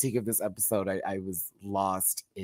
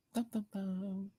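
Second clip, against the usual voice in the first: ominous dum dum dum; 8 semitones higher, 12 dB quieter than usual. Pretend. Dramatic reveal sound.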